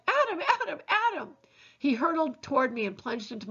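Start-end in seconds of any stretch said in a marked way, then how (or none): 0.1-1.4 calling out high across the garden; little brother crying out for Adam; shouting a name. Acted.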